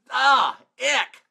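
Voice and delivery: high noise of revulsion; acted disgust. Coach recalling getting people to sell, acted disgust.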